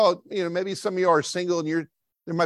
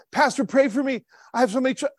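high, pleading whine to the pastor; high mock whining plea; whiny complaint; 9 semitones higher, faster than usual. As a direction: beg the pastor like a self-pitying churchgoer. Acts whining churchgoer asking for prayer.

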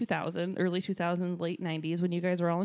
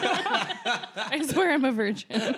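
drawn-out slow innocent plea; slow mock protest; 4 semitones higher, much slower than usual. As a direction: plead your innocence slowly.

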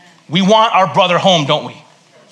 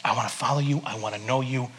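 soft, lower prayerful voice; a hushed, humble prayer; 7 semitones lower, 10 dB quieter than usual. Shown as a demonstration. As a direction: pray it quietly from the heart. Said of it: "you gotta pray and say".

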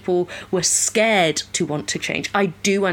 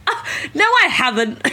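mock defensive denial; 7 semitones higher than usual. Her own defensive denial retold.

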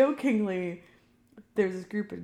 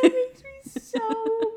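slow, high overwhelm; 5 dB louder, much slower than usual. Real, not acted. Overwhelmed reaction.

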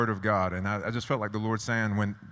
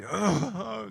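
high frustrated ugh at himself; exasperated high-pitched ugh after a miss; frustrated groan; 9 semitones higher than usual. Retold or imitated. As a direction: groan like you just missed a shot. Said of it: "I would just be".

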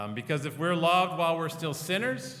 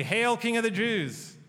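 mocking jeer; 7 semitones higher, 4 dB louder than usual. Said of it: "when we mock him and say".

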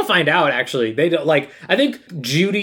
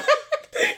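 shrieking laugh; 4 dB quieter than usual. Real, not acted.

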